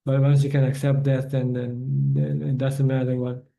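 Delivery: usual speaking voice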